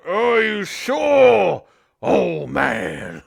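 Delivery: put-on loud, high, slow jeer at the old man; wrestler mocking taunt. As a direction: sneer down at the old challenger. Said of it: "And he goes".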